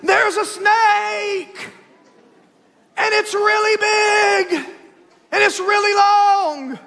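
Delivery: put-on high, slow, shouted child's alarm; child shrieking about a snake; scared little girl. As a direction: play the panicked daughter yelling about the snake. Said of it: "and she goes".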